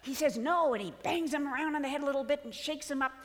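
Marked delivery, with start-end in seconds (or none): 0.4-0.7 loud, higher, firm no; emphatic refusal. Acted. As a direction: cut the bleating short. Lion scolds the sheep lion.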